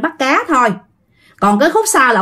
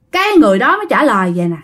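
usual speaking voice

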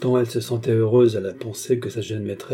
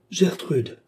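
exclaiming; 8 semitones higher than usual. A narrator's reading. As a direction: exclaim the name reproachfully on a falling pitch. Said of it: "s'écria Bastien".

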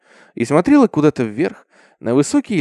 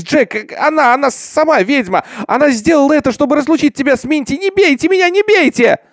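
very high, stammering, desperate pleading; frantic shrieking; 11 semitones higher, 4 dB louder than usual. A narrator's reading. Girl protests as they tie her up, shrieking. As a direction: plead wildly, climbing higher to the end. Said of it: "Она визжала, пока они связывали её".

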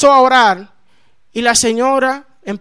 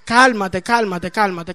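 lower, softening repeated reassurance; calming down; 4 semitones lower, 3 dB quieter, slower than usual. Retold or imitated. José calming the alarmed new convert, soothingly. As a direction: settle a frantic friend, voice sinking softer. José calms the frantic narrator.

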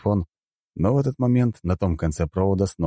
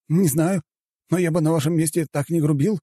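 high-pitched, timid assistant; mildly frightened; 11 semitones higher than usual. A narrator's reading. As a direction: cautiously warn the boss. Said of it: "испуганно проговорил мужчина". (слегка испуганно, высоким голосом)

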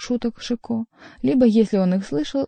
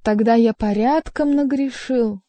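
higher, plaintive admission; theatrical lament, pitched up; despairing cry; 4 semitones higher, 4 dB louder than usual. A narrator's reading. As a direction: wail it in mock despair. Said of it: "с отчаянием вскричал Шико".

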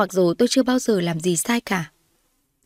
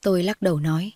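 plain reading voice

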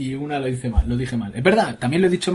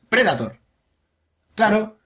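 ordinary talk, nothing marked

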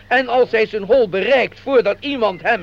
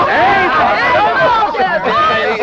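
very slow, higher reveal line; dramatic reveal; 8 semitones higher, 4 dB louder, much slower than usual. Acted. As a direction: stretch out the reveal. Host reveals the punchline. (langzaam en hoog onthuld)

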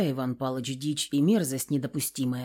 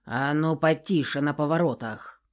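usual speaking voice

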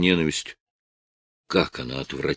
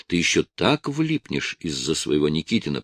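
plain reading voice

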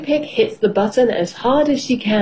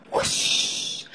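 soft, breath-only whoosh; whooshing arrow; 9 dB quieter than usual. A narrator's reading. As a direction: imitate an arrow flying with a soft rush of air. Imitates flying arrow.

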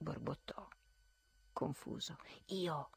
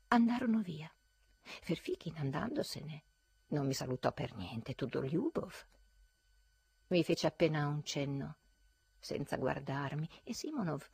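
usual speaking voice

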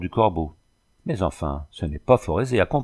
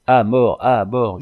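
loud howl of death; mob howling; 3 semitones higher, 6 dB louder, slower than usual. A narrator's reading. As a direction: howl it from all sides. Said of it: "hurlait-on de toutes parts".

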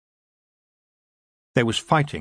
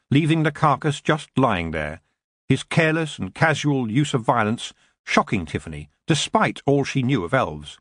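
ordinary delivery

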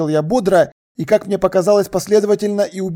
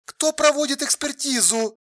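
high, soft but demanding woman; 7 semitones higher, 7 dB quieter than usual. A narrator's reading. Alla pressing him about the expertise, demanding. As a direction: demand an answer in crisp words. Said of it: "требовательно отчеканила Алла".

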